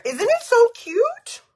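high, slow, gushing squeal. Real, not acted. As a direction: coo over it delightedly. Gushing over mirror.

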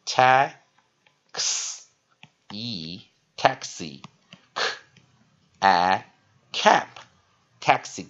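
"taxi" and "cab" broken into slow separate sounds; sounding out syllables. Shown as a demonstration. Teacher writing it out, "taxi cab" sounded out piece by piece. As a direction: sound out "taxi", then k, a, "cab", with long pauses. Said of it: "所以写起来".

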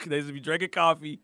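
usual speaking voice

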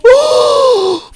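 very high, drawn-out gasp; alarmed gasp. Acted. Player spotting the coming tsunami, alarmed high gasp. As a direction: suck in air in high alarm.

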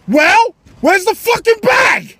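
mock wife shouting. Wife impatiently demanding the bag, shouting. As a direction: yell the question at him. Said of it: "she's like".

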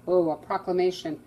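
plain reading voice

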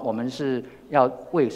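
normal talking voice

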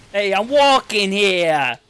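put-on loud, high, drawn-out American city accent; American East Coast accent. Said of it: "That is an accent".

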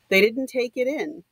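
plain reading voice

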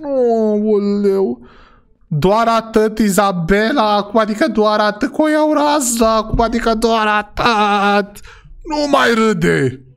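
high, drawn-out whine of dismay; mock-distressed wail. Shopper reacting to the price, wailing in dismay. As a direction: wail with stretched-out words.

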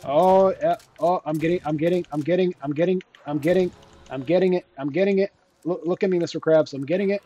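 high, loud, rapid-fire repetition; frantic excitement. Acted. Gamer surviving a zombie horde, frantically excited. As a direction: shout it in a mounting frenzy.

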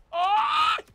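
loud high scream of alarm; real high-pitched shriek; startled yelp. Real, not acted. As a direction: yelp sharply at a sudden hit. Hit during in-game fight.

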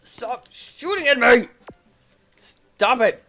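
high, loud protest; exasperated yell. Real, not acted. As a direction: yell it slowly at the attacker. Yelling at shooting game enemies.

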